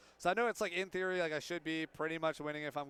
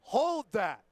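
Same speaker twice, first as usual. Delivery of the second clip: hyped gloat; 5 semitones higher, 5 dB louder, much slower than usual. Real, not acted. Gloating after winning RPS.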